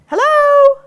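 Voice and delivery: very high, loud doorbell hello; loud distraction hello. Shown as a demonstration. Trainer testing the dog with a loud greeting, high and loud. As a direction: sing it out like greeting a guest at the door. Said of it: "the things that distract our dogs".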